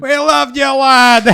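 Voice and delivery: put-on cheery Scottish accent, high and bright. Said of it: "bust your Scottish accent out".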